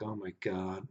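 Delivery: a low disbelieving mutter; exasperated under breath. Retold or imitated. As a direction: barely voice it, fed up. Facepalming at rival's celebration.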